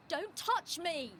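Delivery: high, drawn-out cry of protest; shouted in high pitch, not full volume; shouted warning. A narrator's reading. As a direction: shove someone off with your voice. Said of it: "I shouted".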